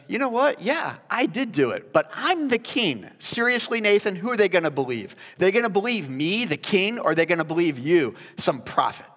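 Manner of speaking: arrogant boast. Pretend. David scoffing at Nathan, arrogant loud and high. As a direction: lord it over him.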